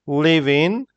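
'living' as two words, 'live in', rising; 'living' split before the 'in'; careful spelled-out form. Shown as a demonstration. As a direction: say 'live, in' apart, pitch up at the end. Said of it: "how we spell it".

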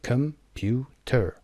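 com, pu, ter: computer split into three; syllable by syllable. Shown as a demonstration. Teacher shows three syllables to learners, computer broken apart. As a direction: break computer into slow, separate syllables. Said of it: "سه سیلابیه".

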